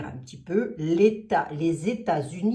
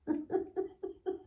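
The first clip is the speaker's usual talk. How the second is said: high giggle; 12 semitones higher, 9 dB quieter than usual. Real, not acted. Continued giggle fit.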